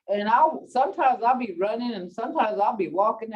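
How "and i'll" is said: ordinary delivery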